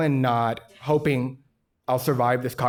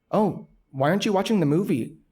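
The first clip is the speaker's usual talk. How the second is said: higher, lilting questioning voice; puzzled partygoer; 6 semitones higher than usual. Retold or imitated. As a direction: imitate a nosy guest, pitched up. Retold partygoer questioning him.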